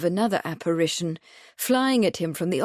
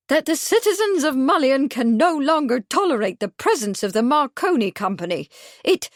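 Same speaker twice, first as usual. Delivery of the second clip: high, cold and emphatic; chilly tone; 6 semitones higher, 4 dB louder than usual. A narrator's reading. Miss Truebody coldly lectures Mr Marsden, high and emphatic. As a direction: thrust each word like an umbrella. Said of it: "in a chilly tone".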